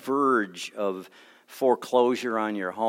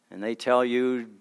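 ordinary delivery

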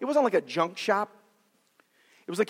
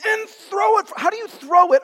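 high, loud comic exclamation; mock bewildered shout; 12 semitones higher, 7 dB louder than usual. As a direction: cry out, then puzzle over it loudly. Acts out hurling a cut-off hand.

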